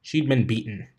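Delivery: ordinary delivery, nothing marked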